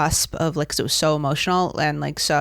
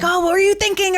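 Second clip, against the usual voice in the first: mock eager question; 3 dB louder than usual. Imitating asking the husband afterward.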